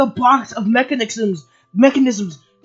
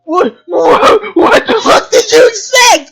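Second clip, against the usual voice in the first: loud, high, sobbing-edged yelling; furious rant; 6 semitones higher, 5 dB louder than usual. Real, not acted. Ranting at the writers, loud and high with a near-sobbing edge. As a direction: yell it out in real exasperation.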